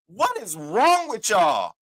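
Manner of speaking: slow, deliberate outraged question; stretched-out demand at the checkout; exact words quoted. Retold or imitated. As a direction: drag it out, mock-indignant. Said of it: "my exact word".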